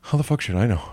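dry impression of a spiritual teacher; lightly voiced guru, brushing off the question. Guru answering an audience spiritual question, lightly put-on dismissive voice. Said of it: "You wanna hear my K D impression?".

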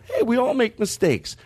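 sarcastic shrug of a line. Shown as a demonstration. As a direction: say it with a hint of sarcasm. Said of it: "I sarcastically go".

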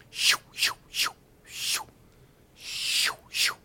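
imitated arrows whooshing past; repeated airy "shoo" sound effects. Hearing anger arrows shot at him, whooshing. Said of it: "Those were arrows, anger arrows being shot".

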